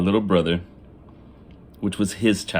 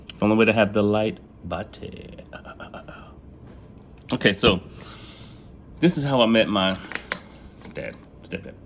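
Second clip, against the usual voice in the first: normal talking voice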